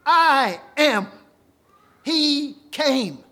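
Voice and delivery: loud, ringing proclamation of I am; booming declaration. Acted. Preacher voicing Jesus to Martha, booming declaration. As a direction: declare it with full force to the mourners.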